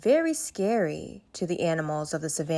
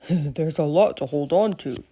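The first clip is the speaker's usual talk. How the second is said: playful teasing, lightly voiced; joking Mickey; 3 semitones lower, 3 dB louder than usual. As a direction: tease the giraffe about her long neck. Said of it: "joked Mickey".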